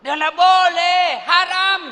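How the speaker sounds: slow, loud, high refusal; firm refusal. Acted. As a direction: refuse it slowly and loudly. Yusuf refusing firmly. (penolakan tegas, pelan dan keras)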